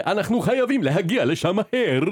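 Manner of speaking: high, exaggerated cartoon-dub delivery; Hebrew dubbing intonation. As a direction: parody an over-acted dubbed cartoon. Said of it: "האינטונציה שיש לדיבוב עברי". (אינטונציה מוגזמת של דיבוב עברי)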